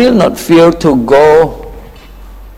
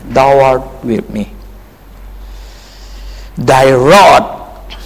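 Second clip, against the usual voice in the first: ordinary talk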